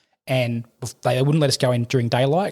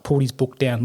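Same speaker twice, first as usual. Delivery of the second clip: usual speaking voice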